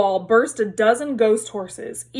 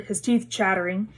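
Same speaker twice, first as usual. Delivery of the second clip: usual speaking voice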